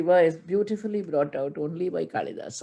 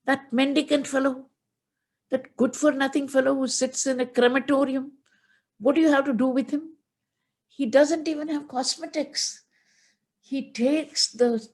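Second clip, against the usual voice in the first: higher, scornful put-down; disguised Shiva mocking himself to Parvati, higher and scornful; 7 semitones higher than usual. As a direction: sneer at the beggar god. Brahmin boy mocking Shiva.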